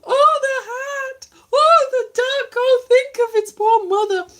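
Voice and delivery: high, loud hammed-up wailing over a duck; mock sobbing. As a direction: wail like it is a tragedy. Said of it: "I think I've gone a little bit stir crazy".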